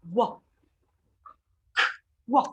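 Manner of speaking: "Wa" as one short isolated syllable; W sound. Shown as a demonstration. Teacher before joining K and W, isolated "Wa". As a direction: give only the sound of W, "Wa". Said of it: "W sound is what?".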